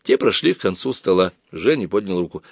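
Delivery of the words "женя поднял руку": ordinary delivery, nothing marked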